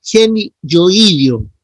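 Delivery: teacher drilling the term slowly; correct stress. Shown as a demonstration. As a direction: model Geni yoidio carefully. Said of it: "Esa es la forma como acentúan los músculos".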